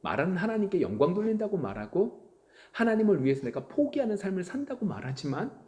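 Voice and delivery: ordinary talk, nothing marked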